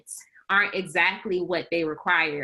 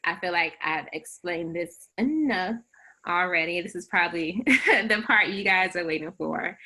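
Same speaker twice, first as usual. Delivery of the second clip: ordinary delivery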